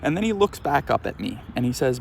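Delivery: ordinary talk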